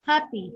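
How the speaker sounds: "happy" with a high HAP, falling after; stress on first syllable. Shown as a demonstration. Teacher repeating the last example adjective, first-syllable stress. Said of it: "stress here is found in the first".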